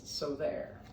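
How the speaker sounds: lively read-aloud storytelling; picture book reading voice; story narration. A narrator's reading. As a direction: read it to the class with bounce. Teacher reads picture book aloud.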